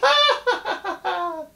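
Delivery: high peal of laughter. Acted. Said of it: "Brer Rabbit laughed some more".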